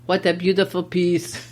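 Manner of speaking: put-on German-accented shoemaker admiring the leather; broken German English. Teacher voicing Gessler in broken German English. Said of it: "I'll practice my broken German English".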